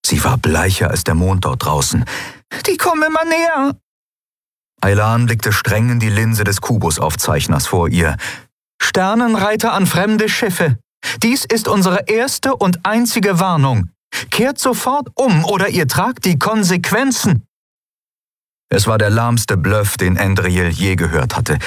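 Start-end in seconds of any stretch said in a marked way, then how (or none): 2.7-3.8 high, urgent cry of alarm. Acted. Crew member warns the others that they approach, alarmed. Crew watches attackers close in. 8.8-17.4 high, deliberate official warning; commanding warning broadcast. Acted. Aylan warns the strange ships to turn back, stern.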